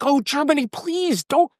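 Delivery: pleading, pitched far up; hammed-up desperate appeal; mock pleading. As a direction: beg Germany in a hammed-up plea. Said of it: "to be like".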